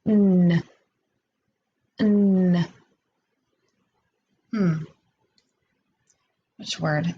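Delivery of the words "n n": n hummed alone and held; isolated n sound. Shown as a demonstration. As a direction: sound out the lone n. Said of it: "ends in the sound".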